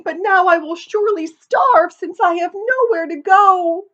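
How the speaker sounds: high, loud, desperate dog voice; a worn-out hunting dog pleading; frightened old dog. Acted. Dog voice in the story.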